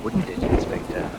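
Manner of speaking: whispered menace, close to his ear; menacing whisper. Acted. Killer whispering a threat to the cornered inspector. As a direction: breathe it as a quiet threat.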